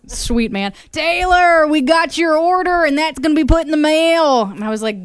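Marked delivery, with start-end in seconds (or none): 0.9-4.4 higher, louder Southern seller voice; Southern accent. Retold or imitated. Host retells the seller's Southern shout-out, in a Southern accent. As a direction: greet her like a friendly Southern guy. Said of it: "in his Southern accent".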